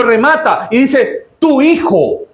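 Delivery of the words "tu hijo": scornful. Acted. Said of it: "un desprecio brutal".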